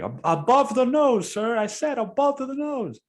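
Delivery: squeaky, high yelling impression; mocking the driver. Driver yelling about the mask, squeaky high mock yell. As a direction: shriek it like a petty bus driver. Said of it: "He's like".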